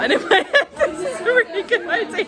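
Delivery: high, giggling through words; laughing. Real, not acted. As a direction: laugh while saying it. Laughing at own idea.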